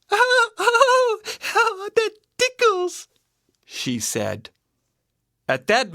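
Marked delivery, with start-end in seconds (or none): 0.1-3.1 put-on very high, ticklish little-girl voice; giggling Goldilocks. A narrator's reading. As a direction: squeal as if being tickled. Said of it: "moving her feet and giggling".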